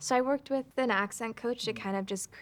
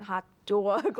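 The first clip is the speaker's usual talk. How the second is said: 'hot dog' with a thick Brooklyn vowel, pushed and louder; heavy Brooklyn accent; 4 dB louder than usual. Shown as a demonstration. Director coaching the actress on the Brooklyn accent. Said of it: "Like, really hit the accent".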